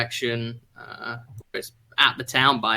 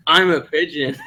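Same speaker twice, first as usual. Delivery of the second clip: laughing; 6 semitones higher than usual. Acted. Laughing pigeon line.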